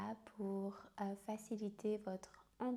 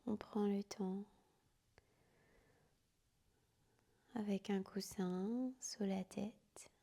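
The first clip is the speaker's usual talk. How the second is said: guided sleep meditation. Acted. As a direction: whisper them gently down into sleep. Yoga nidra guided to sleep.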